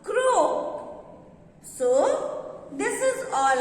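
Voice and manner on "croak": teacher repeating croak; stressed key word. Shown as a demonstration. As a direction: croak said high and drawn out. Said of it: "The call of a frog is called".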